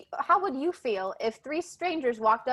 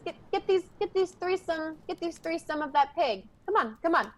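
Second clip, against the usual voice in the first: higher-pitched orc voice; orc companion; 7 semitones higher than usual. Acted. Leader to orc about sharing pig, higher orc voice. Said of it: "the orc looks over at him and he says".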